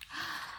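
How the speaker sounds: soft whispered gasp of surprise; mock gasp. Surprise before turning page.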